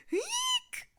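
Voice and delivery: very high squeaky shriek, not loud; mock scream. A narrator's reading. Goldilocks wakes to bears, high mock scream. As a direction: squeal it as high as you can. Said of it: "screamed Goldilocks".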